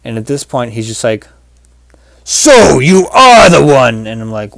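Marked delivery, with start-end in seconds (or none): 2.2-4.1 loud, slow, raised mock-villain proclamation; huge drawn-out dramatic declaration; booming dream dog. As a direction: bellow it like a hammed-up nemesis.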